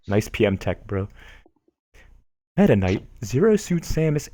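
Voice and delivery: ordinary delivery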